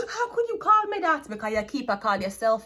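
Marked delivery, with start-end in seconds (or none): 0.1-1.3 imitating a girlfriend, higher and crying out; mock offended gasp. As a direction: gasp high, then a hurt mock cry. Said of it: "as soon as someone say".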